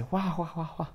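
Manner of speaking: put-on "wah-wah" laugh; mocking crowd laughter. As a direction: fake a group laughing at him. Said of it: "todos empezaron a reírse".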